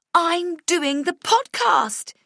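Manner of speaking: high, loud, slow triumphant cry; triumphant yell. A narrator's reading. Coworker yelling her triumph to the office. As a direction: shout it out in victory. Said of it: "she yells".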